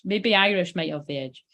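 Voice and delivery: ordinary delivery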